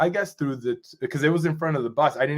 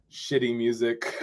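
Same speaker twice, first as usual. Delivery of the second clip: normal talking voice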